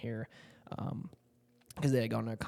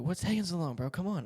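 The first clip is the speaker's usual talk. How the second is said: higher, pushy hurry-up; impatient dad; 6 semitones higher, 3 dB louder than usual. Retold or imitated. Dad rushing his son under the car, higher impatient nag.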